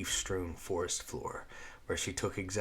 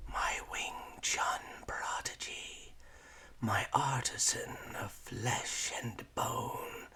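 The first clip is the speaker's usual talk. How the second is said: slow, drawn-out whisper; ghostly whisper; 4 semitones higher, 4 dB quieter, slower than usual. A narrator's reading. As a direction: breathe the words like a spirit calling. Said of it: "it whispered".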